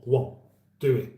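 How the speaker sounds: ordinary talk, nothing marked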